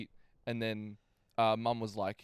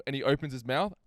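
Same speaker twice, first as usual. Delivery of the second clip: ordinary talk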